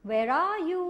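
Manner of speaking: high, slow calling into the dark. Acted. Jobiho calling the creatures in the dark mill, high and slow. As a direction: call out as if searching a big room. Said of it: "he called out".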